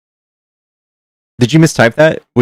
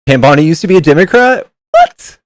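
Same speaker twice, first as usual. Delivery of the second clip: stunned, loud what; real shock at the news; shocked disbelief; 6 semitones higher, 6 dB louder than usual. Real, not acted.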